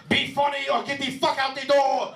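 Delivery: high-pitched yelled threat in a mock Armenian accent. Imagined club owner threatening a comic, Armenian-accented yell. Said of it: "this Armenian guy wearing".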